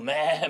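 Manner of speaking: long mock animal cry; imitated bleating. Preacher mimicking the spared livestock, bleating. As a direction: bleat like a sheep left out back. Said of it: "막 울고 있는 거".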